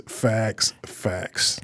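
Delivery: facts fading down into a whisper. Real, not acted. Co-host agreeing about Batman, fading to whisper. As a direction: let each facts fade off to a breath.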